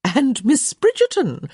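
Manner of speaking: high, drawn-out society greeting; effusive. A narrator's reading. Hostess turning to the next guest, effusive. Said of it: "Lady Plainsworth said effusively".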